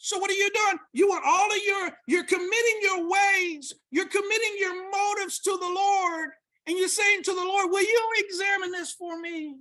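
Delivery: loud, high, driving sermon voice; preaching at full force. Acted. Preacher urging the congregation to commit, loud and high. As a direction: press it home to the congregation, full voice.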